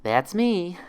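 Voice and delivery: forced cheerfulness. Acted. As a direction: sell a fake cheerful greeting. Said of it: "forced cheerfulness".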